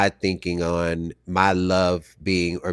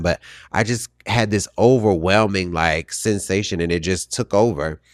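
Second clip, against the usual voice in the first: ordinary delivery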